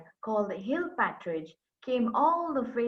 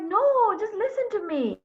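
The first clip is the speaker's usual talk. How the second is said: desperate plea; 10 semitones higher than usual. Acted. Begging the angry partner.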